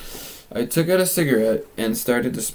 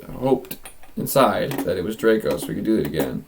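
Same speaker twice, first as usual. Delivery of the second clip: usual speaking voice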